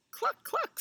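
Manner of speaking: very high double cluck; clucking cow. Acted. Cow calls to Goat in a story, high clucking. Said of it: "said Cow".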